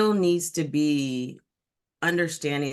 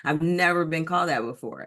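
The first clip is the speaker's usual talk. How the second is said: ordinary talk, nothing marked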